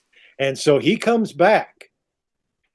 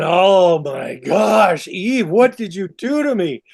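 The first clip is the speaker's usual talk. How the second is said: drawn-out gasping "oh my gosh", then pitched up; astonished outburst in retelling; mock amazement; 2 semitones higher, slower than usual. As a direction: play a man blown away. Adam amazed after first climax.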